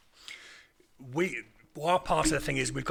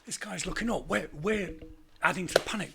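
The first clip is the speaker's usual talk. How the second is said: ordinary talk, nothing marked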